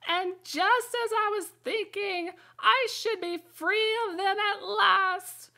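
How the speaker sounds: building from high whine to a very high, loud, slow shriek; pigeon's shriek. A narrator's reading. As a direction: rise into a hysterical bird shriek. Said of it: "raising its voice to a shriek".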